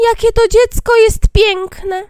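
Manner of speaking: very high, loud, slow wonder; admiring dwarves. Acted. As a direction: marvel aloud like enchanted little dwarves. Dwarves admire sleeping girl. (zachwycone krasnoludki, wysoko i powoli)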